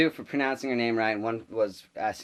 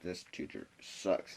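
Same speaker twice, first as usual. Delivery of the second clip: slow, hushed, lower mutter; retold whispering; 4 semitones lower, 10 dB quieter, slower than usual. Classmates about the substitute, hushed imitation of whispering. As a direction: confide it under your breath. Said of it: "we're kinda whispering".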